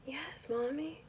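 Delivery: breathy whisper into the phone. Real, not acted. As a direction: hush it like on a call. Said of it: "Why are you whispering".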